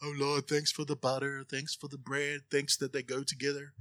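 mock rote grace, low and very quiet; casual prayer. Preacher mimicking casual grace at the table, low and perfunctory. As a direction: mumble a token mealtime prayer on autopilot. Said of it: "the time for casual prayer".

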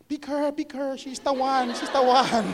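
put-on high, excited Filipina accent; Filipina friend. Friend urging the preacher to pick her, excited Filipina accent. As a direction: imitate an eager Filipina friend pushing her forward. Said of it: "a Pinay, was a Filipina".

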